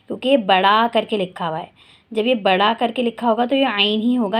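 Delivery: ordinary talk, nothing marked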